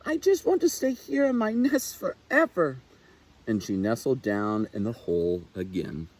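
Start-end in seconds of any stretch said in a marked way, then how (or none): 0.0-2.8 high, small frightened owlet voice; a timid baby owl clinging to safety; scared little owl. A narrator's reading. Little Owl telling herself she'd rather stay, high and scared. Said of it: "Little Owl cried".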